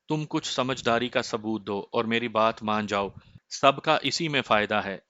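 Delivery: plain reading voice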